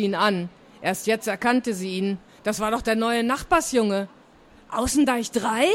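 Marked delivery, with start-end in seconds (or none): none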